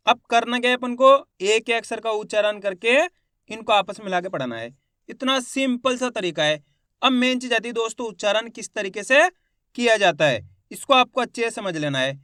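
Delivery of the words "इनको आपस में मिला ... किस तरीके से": ordinary delivery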